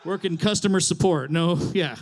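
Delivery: usual speaking voice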